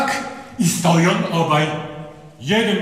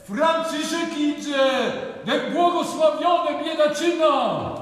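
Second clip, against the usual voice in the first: shouting; 8 semitones higher than usual. Acted. Villager running in, shouting to people. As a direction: cry out the news to everyone. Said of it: "krzycząc".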